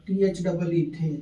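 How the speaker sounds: ordinary delivery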